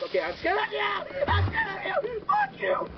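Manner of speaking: squeaky falsetto scream of fear; shrill falsetto panic; panicked squeal. Real, not acted. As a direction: squeal it in a terrified high voice. Mob overwhelms him, panics.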